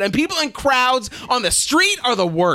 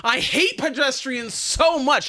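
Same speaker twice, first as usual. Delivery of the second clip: exasperated, higher-pitched ranting; indignant comic tirade, pitched up; worked-up rant; 5 semitones higher than usual. Real, not acted. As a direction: rail at foolish pedestrians, getting carried away. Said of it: "Oh, I am so worked up".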